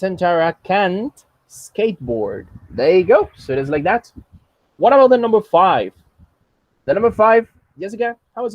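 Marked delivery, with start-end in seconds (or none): 0.0-2.5 slow, spaced-out teacher model; measured classroom demonstration at half speed; slow model sentence. Shown as a demonstration. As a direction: say it slowly for a learner to copy. Teacher models student's sentence slowly.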